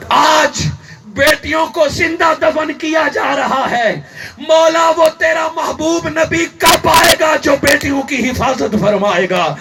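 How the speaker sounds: forceful sermon storytelling; impassioned preaching. Acted. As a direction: preach it out line by line. Child's prayer preached out.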